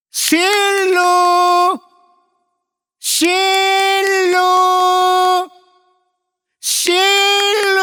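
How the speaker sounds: high, drawn-out sing-song call. Retold or imitated. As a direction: call the wife from downstairs, stretched. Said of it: "એણે એના મિસિસને બૂમ મારી". (પત્નીને ઊંચા લંબાવેલા સૂરે બૂમ)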